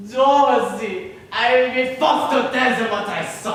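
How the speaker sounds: loud threat. Acted. Pierre warning Dorothy he will tell, loud threat. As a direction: threaten her at full voice. Blackmailer threatens his victim.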